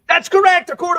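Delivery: high, loud game-show confirmation; cheering that's correct; excited host. Real, not acted. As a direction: celebrate the right answer.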